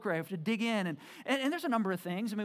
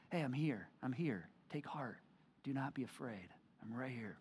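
gentle reassurance; 7 semitones lower, 9 dB quieter than usual. Acted. Jesus voiced comforting the congregation.

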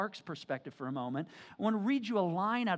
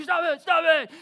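mock panicked yell; 10 semitones higher, 13 dB louder than usual. Brother panicking.

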